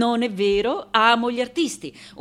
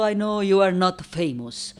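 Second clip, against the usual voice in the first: usual speaking voice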